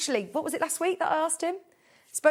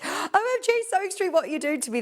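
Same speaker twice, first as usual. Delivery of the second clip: high, gasping mock outburst; gasp, then gush high and come gradually down; excited read-out; 9 semitones higher than usual. Presenter reads a viewer's gushing message, excited and high.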